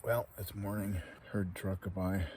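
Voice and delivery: usual speaking voice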